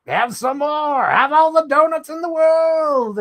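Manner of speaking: high and loud, pushing donuts like a pushy host; pushy algorithm voice. Retold or imitated. Voicing the timeline pushing donuts at the viewer, high and loud. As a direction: shove the donuts at them in a high put-on voice. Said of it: "my timeline will be like".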